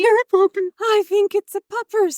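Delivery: excited dog call. Pretend.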